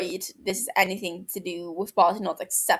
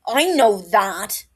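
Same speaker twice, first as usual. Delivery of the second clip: louder, slow and sharp, cutting him off; sharp cut-off; 8 dB louder, much slower than usual. A narrator's reading. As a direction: bite the words off, angry and deliberate. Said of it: "Barty cuts him".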